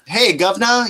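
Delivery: mock British accent. Dad repeating his son's British accent bit, mock Cockney. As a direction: toss off a mock Brit hello. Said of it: "he does that whole shit".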